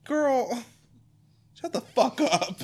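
shrieking exasperation; exasperated. Real, not acted. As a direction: cut her off in disbelief. Exasperated reaction to dialogue.

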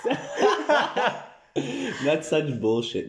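slow, laughing protest. Real, not acted. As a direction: laugh through the denial.

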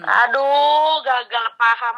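stuffy nasal voice from a blocked nose. Real, not acted. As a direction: talk through a blocked nose. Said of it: "mana suara gue bindeng".

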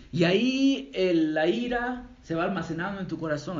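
usual speaking voice

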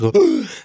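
sharp high gasp of shock. Retold or imitated. Recapping Harry's reaction, a sharp gasp. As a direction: gasp like Harry hearing his name.

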